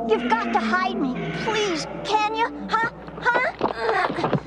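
begging for a hiding place; plead, tacking on eager little huhs; mildly desperate begging; desperate plea. Acted. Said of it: "He sounds desperate".

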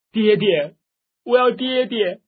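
put-on high, loud childlike wail; wailing cry. A narrator's reading. Bereaved girl keeps calling for her father, wailing cry. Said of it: "黄蓉却只是哭叫".

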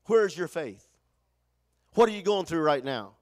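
ordinary talk, nothing marked

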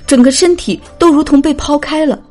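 usual speaking voice

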